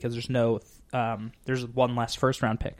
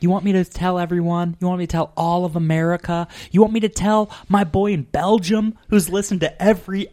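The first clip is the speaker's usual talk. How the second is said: raised, louder mock indignation; incredulous challenge; 6 semitones higher, 7 dB louder than usual. Telling mom's secret to everyone, mock indignant. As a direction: build a pointed rhetorical challenge, pitched up. Acted exasperated challenge to mom.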